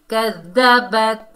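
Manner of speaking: कज़्ज़बत with the tongue stuck on ta, no echo; ta held flat. Shown as a demonstration. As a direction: say कज़्ज़बत without qalqala. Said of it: "ऐसे।". (बिना कलकला के कज़्ज़बत)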